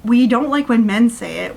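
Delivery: usual speaking voice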